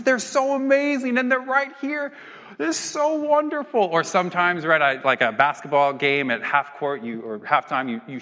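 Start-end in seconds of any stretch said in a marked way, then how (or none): 0.0-3.8 high-pitched tearful gushing; mock crying fan. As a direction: gush in a high, hammed-up sob. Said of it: "they just start crying".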